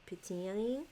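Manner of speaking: ordinary talk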